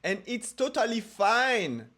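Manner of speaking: slow, loud English with a French accent. Shown as a demonstration. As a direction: speak English slowly in a thick French accent. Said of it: "parler avec l'accent français".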